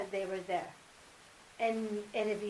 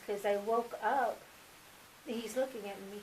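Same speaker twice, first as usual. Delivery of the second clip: usual speaking voice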